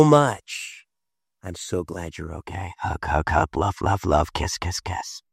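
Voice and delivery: low, tender comforting voice; a gentle father calming his daughter; soothing a child. Retold or imitated. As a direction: hush and reassure a scared little girl.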